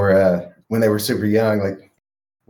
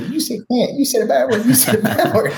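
much higher, laughing mock child tattle; tattling little kid. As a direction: tattle like a giggling little boy. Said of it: "telling me".

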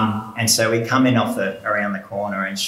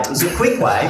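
ordinary talk

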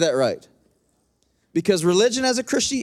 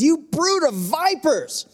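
higher, slow accusing preacher's cry; righteous anger, drawn out and raised; fierce rebuke; 7 semitones higher, slower than usual. Retold or imitated. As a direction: hurl it at the religious leaders. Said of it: "He didn't hold back".

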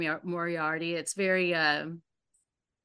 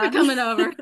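laughing; 4 semitones higher, 10 dB louder, faster than usual. Real, not acted. Jokes about inviting themselves over.

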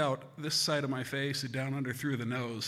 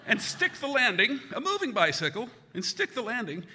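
high, loud showman's pitch; carnival barker; 9 semitones higher, 5 dB louder than usual. Acted. Marketing his own bike crash to a crowd, hammed-up barker. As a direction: hawk it to the crowd like a ringmaster. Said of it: "I probably could have marketed the thing".

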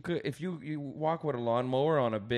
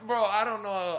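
laughing; 8 semitones higher, 7 dB louder, slower than usual. Real, not acted. Laughing at the story.

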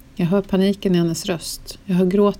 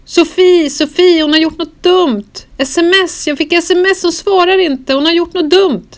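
high, loud, frantic cries; a friend screaming down the phone in panic; panicked screaming; 11 semitones higher, 7 dB louder than usual. Retold or imitated. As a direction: scream it out in terror, pitch way up and loud. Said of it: "skriker hon nu".

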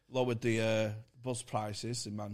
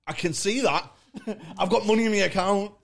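retold indignant retort; 9 semitones higher, 8 dB louder than usual. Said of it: "I went".

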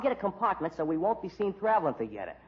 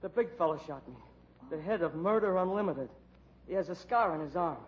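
weak dying voice; 4 dB quieter than usual. Acted. Dying man describing killer.

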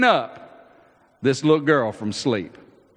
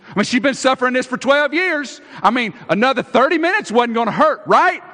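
fast, high, loud exasperated protest; loud, quick and sarcastic; impatient sarcasm; 9 semitones higher, 6 dB louder, faster than usual. Acted. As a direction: push it through, fed up with the delay. Preacher voices impatient Jairus.

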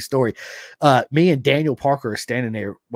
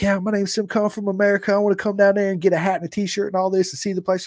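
eager phone pitch; 9 semitones higher than usual. Retold or imitated. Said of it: "And I was like".

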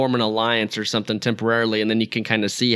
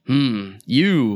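put-on suspicious old rebel. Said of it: "where he's like".

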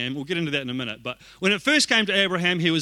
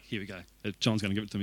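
much softer, lower resigned mutter; muttered aside; 10 semitones lower, 11 dB quieter, much faster than usual. Retold or imitated. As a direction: mutter it to yourself, bracing. Bracing for a rebuke.

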